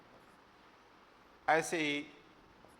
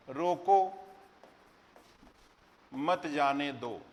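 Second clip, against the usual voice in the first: "रोको" alone, long pause, then a slow "मत जाने दो"; pause after रोको; much slower than usual. Shown as a demonstration. Teacher showing students what punctuation does, pause after "रोको" before "मत जाने दो". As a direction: break after "रोको", keep "मत जाने दो" together. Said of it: "विराम चिन्हों का सही प्रयोग".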